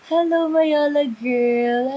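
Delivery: loud, high, drawn-out greeting; booming stretched-out hello; big voice. Real, not acted. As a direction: call it out big and slow. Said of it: "Ang laki ng boses ko".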